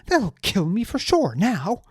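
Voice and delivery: high, loud, outraged aristocrat ranting; indignant high-voiced noble. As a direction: spit out the betrayal in a high indignant voice. Deliritus voice.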